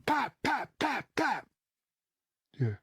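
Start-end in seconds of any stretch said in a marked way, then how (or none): 0.0-1.5 four high, sharp pops; imitated gunfire. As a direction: mimic a burst of shots. Imitating the shots heard.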